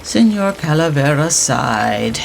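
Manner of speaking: usual speaking voice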